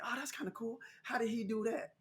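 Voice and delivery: soft, high awe; amazed. Retold or imitated. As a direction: marvel at the trick, hushed. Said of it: "I was just like".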